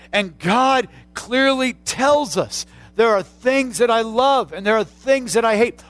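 preacher declaring God's word; emphatic preaching. Acted. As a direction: proclaim it with weight. Preaching at full force.

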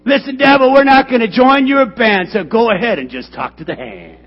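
loud, high, building to a shout; full-force preaching. Acted. Pastor urging church that God meant it for good, shouting. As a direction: build it up and cry it out.

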